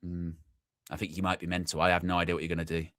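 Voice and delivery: soft, low aside to a cat that smashed a vase; hushed, wary verdict, speeding up at the end; quiet suspicion. Retold or imitated. To the mate's cat after the vase smashes, soft wary suspicion. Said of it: "and you go".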